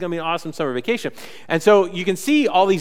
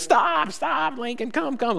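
high calls after a runaway dog; calling the dog; 10 semitones higher, slower than usual. Retold or imitated. Owner calling the runaway dog, high imitated yell. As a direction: call him back, pitched way up.

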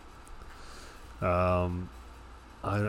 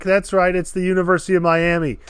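triumphant gloat; 5 semitones higher, 6 dB louder than usual. Real, not acted. Gloating after answering correctly.

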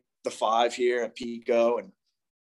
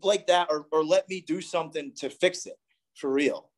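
serious plea; 6 semitones higher than usual. Retold or imitated. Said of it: "and I was serious".

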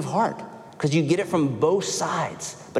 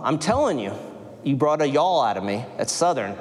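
usual speaking voice

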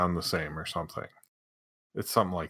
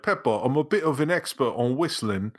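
slightly raised British cartoon-parent voice; mock British accent; 3 semitones higher than usual. Host plays someone advising Peppa on whistling, mock British. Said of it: "it'd be like, you're like".

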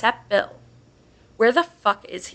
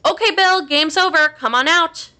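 high, loud calling-out voice; shouting; 7 semitones higher, 4 dB louder than usual. A narrator's reading. Unseen mimic in the woods calls for Bill, shouting. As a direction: holler it across the woods. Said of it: "Whoever it is shouts it again".

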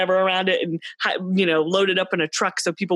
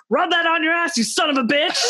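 higher-pitched, retold outburst of cursing; mock angry yell; 8 semitones higher than usual. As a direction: imitate a pissed-off yell at the screen. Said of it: "at the screen and yelled".

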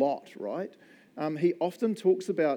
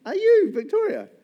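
teasing; 8 dB louder than usual. Real, not acted. Teasing a congregant by name.

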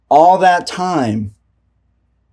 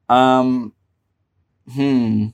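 normal talking voice

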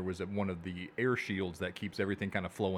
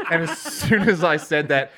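usual speaking voice

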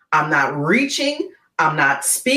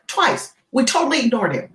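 usual speaking voice

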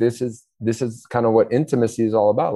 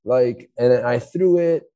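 much higher, slow, mock whiny little kid; crying child; 7 semitones higher, slower than usual. Child complaining to parents about brother, mock whining. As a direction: whine it like a hurt child tattling. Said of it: "And they go".

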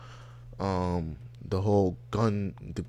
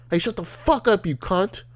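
mock angry yell; 10 semitones higher, 4 dB louder than usual. Yelling at the woo-ers.

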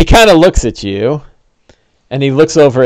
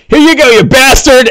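much higher, raised battle cry; gloating orc attack yell; 10 semitones higher, 4 dB louder than usual. Acted. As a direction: yell it while hurling a javelin. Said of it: "he goes".

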